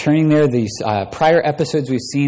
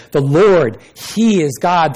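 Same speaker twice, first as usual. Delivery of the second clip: higher and louder cry of awe; the crowd crying out after the fire; awed proclamation; 6 semitones higher, 7 dB louder than usual. Acted. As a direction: shout it out in wonder. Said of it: "fell on their faces and said".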